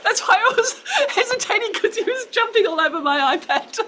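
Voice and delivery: fast, high speech through laughter; laughing. Real, not acted. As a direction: talk while still laughing. Laughing about spider on iPad.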